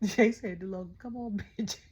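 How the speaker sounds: loud, amused retelling breaking into laughter; laughing. Real, not acted. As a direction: crack up while quoting the insult. Cracks up quoting wrestler's insult.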